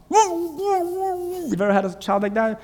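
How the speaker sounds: normal talking voice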